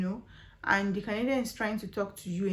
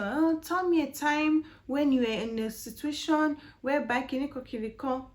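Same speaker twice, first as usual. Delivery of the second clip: mock interviewer; 5 semitones higher than usual. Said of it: "when they ask you a question".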